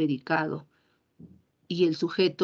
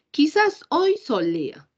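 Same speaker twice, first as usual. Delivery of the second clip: slow, wondering "Quizás hoy solea", pitched higher and louder; doubtful example; 8 semitones higher, 5 dB louder, slower than usual. Shown as a demonstration. Teacher acts out a doubtful sentence, higher and slower. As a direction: muse it out like you're unsure.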